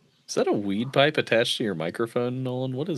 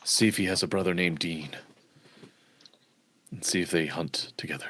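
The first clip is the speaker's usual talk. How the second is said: whisper; 10 semitones lower, 9 dB quieter than usual. Real, not acted. Joking aside to the table.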